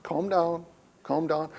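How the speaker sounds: soft, hushed soothing aside; calming wife. Retold or imitated. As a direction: imitate a wife quietly talking him down. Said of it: "she's just like".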